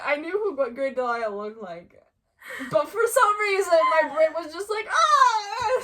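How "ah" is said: high, loud "Ah!"; loud comic cry of overload; mock scream. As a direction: let out a brain-short-circuit yelp. Brain's panicked reaction retold as a cry.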